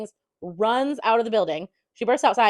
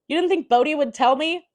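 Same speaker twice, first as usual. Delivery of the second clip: mock indignant; 7 semitones higher, 6 dB louder than usual. Angry partner confronting her.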